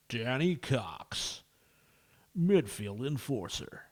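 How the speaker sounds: a slow, drawn-out hype man voice; slow hyped announcer. Pretend. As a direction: sell each player like a trailer, slowly. Hyping up players in slow voice.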